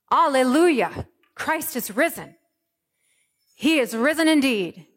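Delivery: loud, high, ringing alleluias; joyful proclamation. Acted. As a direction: proclaim it like an Easter shout of triumph. Easter proclamation, full force.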